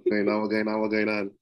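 real Crucian accent, relaxed and easy; natural deep Saint Croix accent. Real, not acted. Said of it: "that deep Crucian accent".

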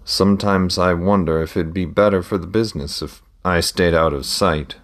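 usual speaking voice

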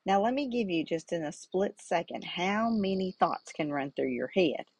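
usual speaking voice

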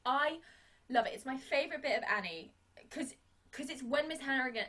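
ordinary delivery

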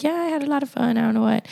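child's answer, imitated. Voices the child's casual answer.

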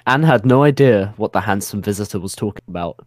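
ordinary delivery

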